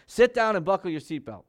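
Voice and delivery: lightly voiced insistent dad giving an order; stern father. Father orders strong-willed child in car, mildly stern command.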